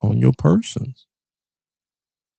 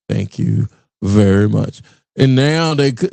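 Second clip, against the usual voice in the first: normal talking voice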